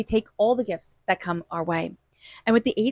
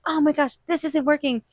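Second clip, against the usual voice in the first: higher-pitched mild exasperation; frustrated; 6 semitones higher than usual. Acted. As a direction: act out getting fed up. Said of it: "we can get so frustrated".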